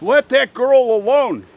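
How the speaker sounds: loud, high shout from far above; yelled down. Retold or imitated. Neighbor yells down from the seventh floor at the attacker, shouting. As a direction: yell it out as an angry-sounding order. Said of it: "he screams".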